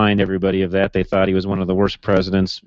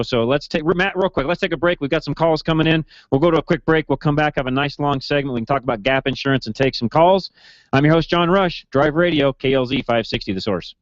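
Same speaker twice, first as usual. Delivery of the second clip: upbeat, higher radio break call; radio host announcer; 5 semitones higher than usual. Acted. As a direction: sell the break like a DJ.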